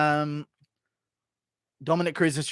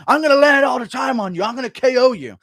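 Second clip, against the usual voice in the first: loud, higher cocky threat; a fighter yelling threats at his opponent, loud and higher; Dominic Cruz trash talk; 7 semitones higher, 8 dB louder than usual. Retold or imitated. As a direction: shout the knockout promise. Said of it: "That's what he does".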